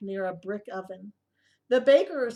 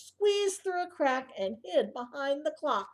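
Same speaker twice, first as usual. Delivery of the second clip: plain reading voice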